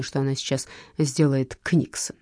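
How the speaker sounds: plain reading voice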